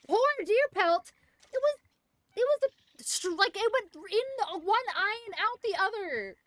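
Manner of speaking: high, loud mock wailing over a pelt; mock grief. Hunter mourning a ruined deer pelt, hammed-up wail. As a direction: mourn it like a tragedy.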